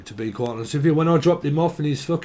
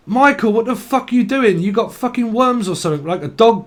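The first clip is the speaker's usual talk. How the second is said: higher and louder, yelling at his mate in disbelief; retold car-seat outburst, higher and louder; mock outraged yell; 8 semitones higher, 4 dB louder than usual. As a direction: bark it at him like he's lost his mind.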